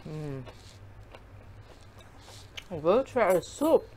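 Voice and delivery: talking while chewing; muffled through food; mouth full, chewing. Real, not acted. Seen 2: Ate leaf at -3s; pursed lips chewing through the labelled words.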